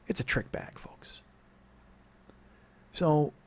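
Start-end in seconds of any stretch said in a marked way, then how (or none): 0.1-1.2 conspiratorial whisper to listeners; whispered aside. Real, not acted. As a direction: lean in and whisper it. Aside to the audience.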